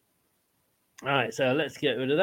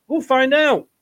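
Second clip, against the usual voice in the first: excited; 8 semitones higher, 6 dB louder than usual. Real, not acted. Excited sign-off hype.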